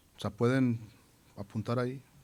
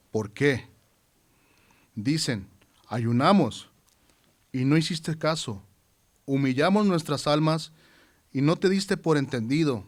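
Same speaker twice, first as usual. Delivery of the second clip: declaimed Bible passage in ringing, measured phrases; scripture reading; 4 dB louder than usual. Acted. Preacher reading God's word to standing congregation, solemn scripture proclamation. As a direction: proclaim the prophet's words to a congregation, pausing between verses.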